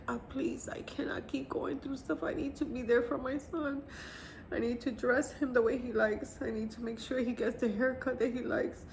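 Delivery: pleading prayer. Retold or imitated. Mother praying to bury her son, desperate pleading. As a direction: beg God for one last chance.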